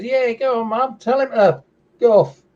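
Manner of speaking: put-on high-pitched baby kangaroo voice; little Joey. Little Joey from the pouch to his mum, high and cheeky. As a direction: egg Mum on in a tiny, high voice. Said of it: "the little Joey pokes its head out".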